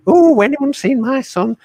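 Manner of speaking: high, loud, drawn-out dame voice; hammed-up pantomime dame making an entrance; panto dame. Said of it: "playing the dame as Widow Twankey".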